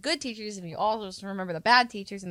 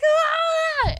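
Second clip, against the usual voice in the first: very high mock scream; imitated scream; 6 dB louder than usual. Teacher screaming at the class, high mock scream. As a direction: shriek it again, hammed up. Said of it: "and she's like".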